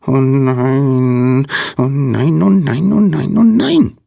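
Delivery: squeaky wail. A narrator's reading. Unknown little someone lamenting in Lina's room, squeaky rising wail. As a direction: pipe up in mounting little wails. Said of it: "piepste jemand".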